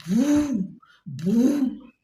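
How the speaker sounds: loud high mock engine booms; imitated engine boom. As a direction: shout out the bike's boom like a sound effect. Bike engine noise imitated.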